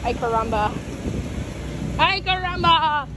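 ordinary talk

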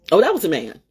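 normal talking voice

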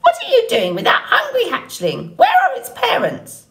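high, squawky scolding bird voice; squawking old goose. A narrator's reading. Old goose confronting the robot, high squawk. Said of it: "squawking at the robot".

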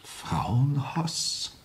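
tonlos geflüstert, fragend ansteigend; ungläubig flüsternd nachfragen; geflüsterte Frage. Acted. Kommissar wiederholt erstaunt. (flüsternd nachgefragt)

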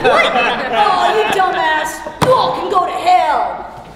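loud, high-pitched outburst; furious yelled insult; angry shout. Acted. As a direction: blow up at the hecklers. Storyteller mocked by listeners.

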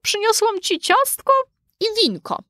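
very high, put-on girlish voice; high character voice, posing as a child; disguised girl's voice. A narrator's reading. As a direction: pitch it way up like a little girl. Wolf poses as the girl.

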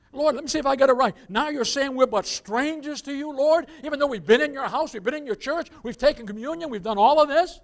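imitating churchgoers pleading their record, loud; protesting churchgoers. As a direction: argue your case with God, hurt and insistent. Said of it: "I can just see them saying".

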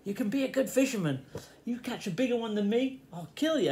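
put-on playfully bossing a fidgety boy; imitating Uncle Pete. Uncle Pete urging the boy to focus on fishing, mock stern. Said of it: "Pete would come and sit down next to me and go".

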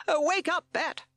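high-pitched loud wake-up call; Captain Beaky shouting. A narrator's reading. Captain rousing sleeping Bat, shouting. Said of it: "he shouted".

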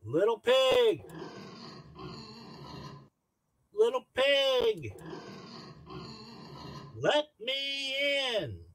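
high, slow wolf at the door; big bad wolf. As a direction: call through the door like the wolf. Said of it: "And he knocked on the door".

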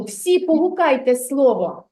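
ordinary talk

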